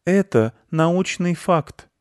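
high, slow, lofty cat voice; condescending. A narrator's reading. Masha the cat condescendingly lectures the ginger tomcat. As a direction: talk down to him with lofty patience. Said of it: "снисходительно".

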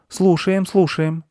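put-on high, compliant kid voice; agreeing children. A narrator's reading. Children placate the cross squirrel, high-voiced. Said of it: "закивали дети".

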